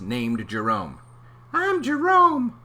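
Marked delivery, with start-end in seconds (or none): 1.5-2.6 very high, slow squeaky voice; a tiny high-pitched cousin; comic high cousin voice. Acted. Voicing cousin Jerome.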